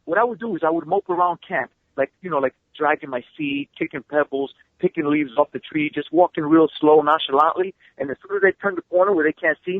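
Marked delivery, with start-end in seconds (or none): none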